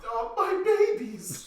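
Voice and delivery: high, drawn-out cartoon coo; hammed-up anguished doting; imitated doting wail. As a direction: wail slowly over beloved little ones. Imitating Mister Krabs cherishing dime.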